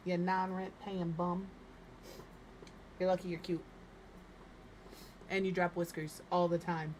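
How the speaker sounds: ordinary talk, nothing marked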